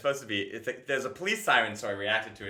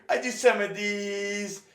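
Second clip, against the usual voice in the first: much higher, slow, showy bragging voice; exaggerated gym bragger; 8 semitones higher, 4 dB louder, much slower than usual. Comic acting out his bit, high slow bragging voice. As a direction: strut it out, slow and high, showing off. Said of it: "I was thinking there could be a thing of".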